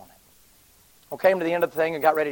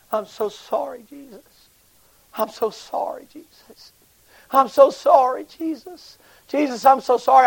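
tearful apology; 11 semitones higher, 8 dB louder, slower than usual. Retold or imitated. Sobbing prisoner's repentant cry.

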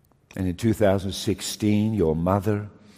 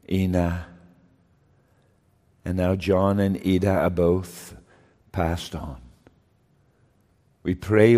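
solemn prayer. Acted. Pastor prays at funeral.

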